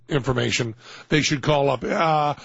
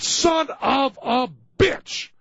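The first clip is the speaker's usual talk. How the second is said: mock angry shout; 8 semitones higher, much slower than usual. Mock outrage over Google ranking.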